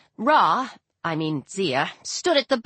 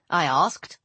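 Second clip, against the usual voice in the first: plain reading voice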